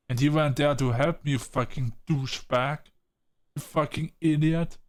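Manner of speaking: heated, rising rant at a friend; angry scolding. Real, not acted. Furious at Frank for not stepping in, angry. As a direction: tear into him for not helping. Player berating Frank's game choice.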